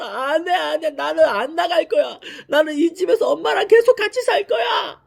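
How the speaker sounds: put-on high, crying little child refusing to leave. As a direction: wail it like a five-year-old in tears. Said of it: "다섯 살 둘째가 막 우는 거예요". (울면서 떼쓰는 다섯 살 아이 목소리)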